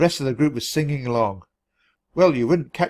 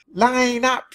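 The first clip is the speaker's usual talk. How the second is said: high shrill command, hammed up; shrieked; 11 semitones higher than usual. A narrator's reading. Penelope waking Stilton with icy water, shrieking. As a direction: shriek the order. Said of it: "she shrieked".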